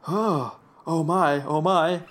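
lightly acted astonishment; mock dismay. Whit spotting something in the cemetery, lightly acted dismay. Whit's reaction in retold cemetery scene.

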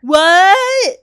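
loud, high shriek of disbelief; shocked. Pretend. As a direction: react to a jaw-dropping reveal. Reacts to the big reveal.

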